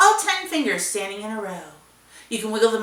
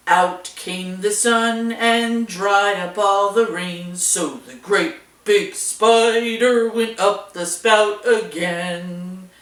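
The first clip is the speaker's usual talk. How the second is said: louder, slow, big booming spider; great big voice; 2 semitones lower, 3 dB louder, slower than usual. Pretend. Big brother spider sings for the kids, big slow booming voice. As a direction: sing it big and heavy like a giant spider. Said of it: "your great big voice".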